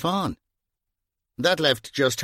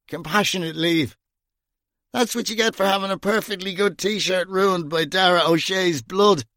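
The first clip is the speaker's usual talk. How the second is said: put-on slurred bitter drunk; 7 semitones higher than usual. A narrator's reading. Drunk Gringo boasting bitterly to Bunny, slurred. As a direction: play it drunk and hurt under the jokes.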